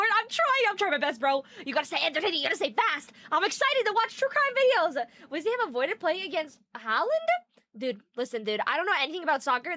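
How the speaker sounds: ordinary delivery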